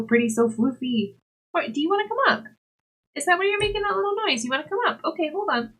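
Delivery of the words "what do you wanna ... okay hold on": sweet high pet voice; cat talk. Real, not acted. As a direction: coo up at the kitty. Talking to the cat.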